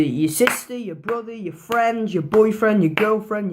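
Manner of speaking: ordinary talk, nothing marked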